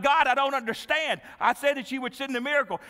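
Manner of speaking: loud, high-pitched protest; bewildered complaint. Acted. Drowned man questioning God about the miracle, bewildered and loud.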